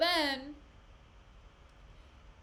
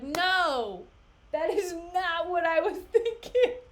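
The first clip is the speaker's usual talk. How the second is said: louder mock protest cracking into laughter; Iago laughing; 5 semitones higher, 7 dB louder, slower than usual. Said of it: "And Iago's like".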